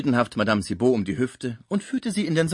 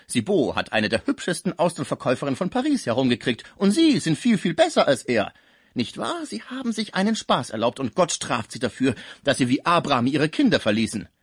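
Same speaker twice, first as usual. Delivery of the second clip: lively, higher-pitched chattering; gossipy concierge; 5 semitones higher, 4 dB louder than usual. Acted. Concierge accuses the patient of hidden children, animated needling gossip. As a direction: prattle on, nosy and accusing.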